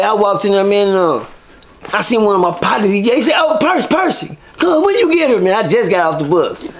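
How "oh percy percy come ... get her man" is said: excited greeting. Retold or imitated. Partner spotting him in the dorm, high excited call. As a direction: shout his name like you spotted an old friend.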